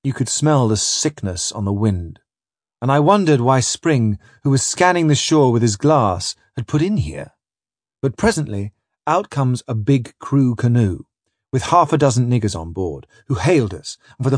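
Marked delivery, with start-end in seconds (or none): none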